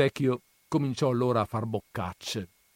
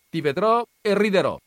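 much higher, louder mocking taunt; mocking grandmother; 11 semitones higher, 9 dB louder, faster than usual. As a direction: crow over him in advance. Grandmother mocks. (la nonna lo schernisce, voce molto più alta e forte)

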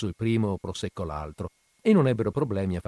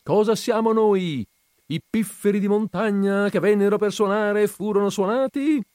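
clown voice; 11 semitones higher, 5 dB louder than usual. A narrator's reading. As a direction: play it up like a clown. Clown addresses the guests.

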